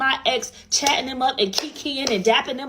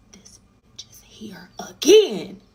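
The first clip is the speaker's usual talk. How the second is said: whisper; 6 dB louder, slower than usual. Retold or imitated. Whispering about the ex again.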